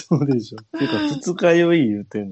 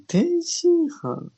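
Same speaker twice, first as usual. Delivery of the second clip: surprised; much slower than usual. Real, not acted. Stunned by the dish's name.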